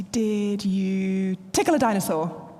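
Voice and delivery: put-on slow, drawn-out teasing question to a toddler; playful silly question. Mum teasing her daughter after nursery, slow and playful. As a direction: stretch it out like a game with a small child.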